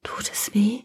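high, soft whisper. Acted. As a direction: ask it in a fragile whisper. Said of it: "es ist nur ein Flüstern".

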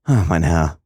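put-on young woman's understated outcry; exclaiming. A narrator's reading. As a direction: cry out to him, mildly. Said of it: "rief die junge Frau aus".

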